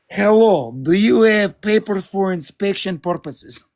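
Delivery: hammed-up Russian accent greeting a customer. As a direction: play a bored inspector with a put-on Russian accent. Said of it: "I pretended like I was Russian".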